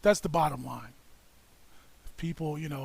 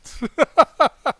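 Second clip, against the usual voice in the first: laughing; 9 semitones higher, 13 dB louder than usual. Real, not acted. Laughs at the taser death story.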